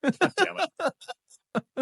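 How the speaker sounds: high, long, drawn-out groan of exasperation. Real, not acted. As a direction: stretch it out, fed up and dramatic. Exasperated at a reused name.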